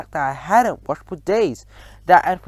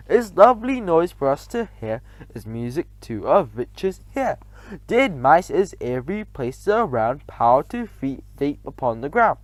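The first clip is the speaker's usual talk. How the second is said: usual speaking voice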